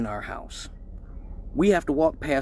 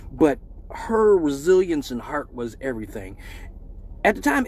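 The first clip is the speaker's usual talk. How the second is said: ordinary delivery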